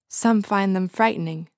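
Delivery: ordinary delivery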